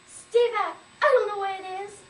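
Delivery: high, loud cry of alarm; urgent, frightened yell; panicked warning. Acted. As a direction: shout them back from danger. Mother warns child away from bed.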